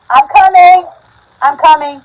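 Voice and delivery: real high, very loud holler; calling out. Real, not acted. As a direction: yell to someone in another room. Calling out to someone off camera.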